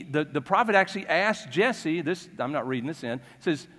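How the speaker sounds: ordinary talk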